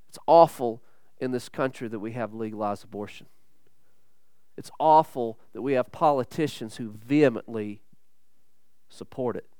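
a preacher lamenting in a hushed voice; low grave lament. Acted. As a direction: mourn it under your breath.

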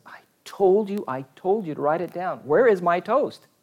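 put-on high-pitched, exasperated old-husband complaint; mock indignation. Joke's husband scolding his wife over the forgotten toast, mock indignant.

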